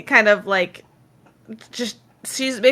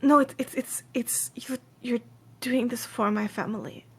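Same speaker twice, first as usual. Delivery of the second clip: hushed, stammering insistence; earnest quest giver; 9 dB quieter than usual. Acted. Woman insisting helper accept family bracelet, hushed and halting.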